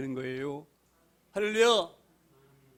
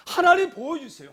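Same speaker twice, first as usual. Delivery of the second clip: higher, louder desperate plea; pleading cry; 7 semitones higher, 7 dB louder than usual. Acted. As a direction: cry out to God begging. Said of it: "시편 기자 심령과 똑같아요". (하나님께 간절히 부르짖는 외침)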